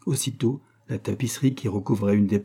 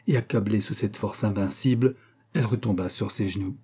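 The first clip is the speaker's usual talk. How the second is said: plain reading voice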